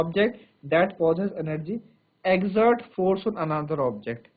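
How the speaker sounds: very slow, each phrase spaced out; slow dictation. Shown as a demonstration. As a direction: dictate slowly for notes. Dictating a definition. (धीरे-धीरे लिखवाना)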